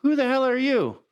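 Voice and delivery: high-pitched mock bewilderment; mock incredulous challenge. Coworker confronting a man with a new haircut, mock incredulous. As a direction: act out not recognizing a coworker.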